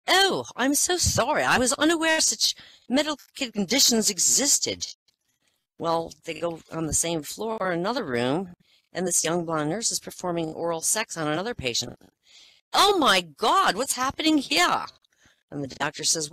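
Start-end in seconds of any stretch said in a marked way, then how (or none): 0.1-4.9 apologetic queen. Acted. Queen Elizabeth apologising to the doctor, high and prim. 12.7-14.8 high, loud, scandalised royal gasp; shocked queen. Acted. Queen Elizabeth catching a patient in the act, high and loud.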